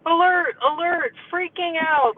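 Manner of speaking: high-pitched alarm, acting out panic; mock red alert. Coach acting out her inner panic at dinner, mock red alert. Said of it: "it's like red alert".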